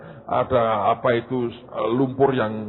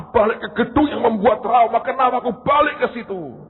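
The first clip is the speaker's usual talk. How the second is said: much higher, loud alarmed cry; panicked ball; 10 semitones higher, 6 dB louder than usual. Acted. Ball alarmed at returning to the stadium, loud and high. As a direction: panic at going back.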